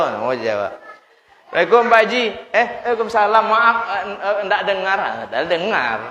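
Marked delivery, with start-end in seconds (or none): 1.6-2.2 high, laughing call of greeting; playful high greeting with laughter; mock eager greeting. Greeting with Haji title. 2.5-5.0 high, mock apologetic excuse; high, lightly mocking pilgrim voice; sheepish reply. Haji answering, feigning not hearing.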